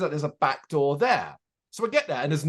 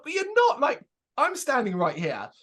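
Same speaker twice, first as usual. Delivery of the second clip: exasperated; 9 semitones higher than usual. Retold or imitated. On the phone to the tour manager, high exasperated protest. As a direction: spell out the obvious, baffled. Arguing on the phone about location.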